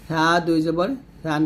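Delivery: lisped, light th in সা and সান; thin thā. Shown as a demonstration. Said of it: "পাতলা করে বলবেন". (সা জিহ্বার আগা উপরের দাঁতে লাগিয়ে পাতলা)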